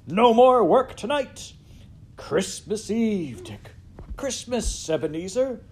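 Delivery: loud, high, festive holler; hearty boss calling out merrily; jovial cry. A narrator's reading. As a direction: announce a holiday to the lads at full voice. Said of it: "cried old Fezziwig".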